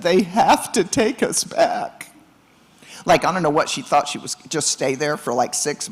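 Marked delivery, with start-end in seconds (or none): 0.0-2.1 higher, slow tearful plea, lightly imitated; a mock sob of "take us back"; retold crying. New mom in the car begging her husband, half-acted crying. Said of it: "I just burst out crying".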